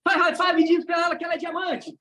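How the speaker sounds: high, driving command to the crowd; rallying shout. Shown as a demonstration. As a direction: fire up the room with it. Hyping the audience into exercise.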